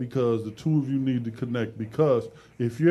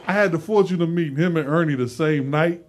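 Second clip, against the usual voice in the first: ordinary talk, nothing marked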